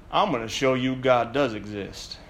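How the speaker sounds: quiet hillbilly determination; hillbilly accent. Retold or imitated. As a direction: Nicolas Cage hillbilly accent as Cameron Poe. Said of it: "with his wonderful hillbilly accent".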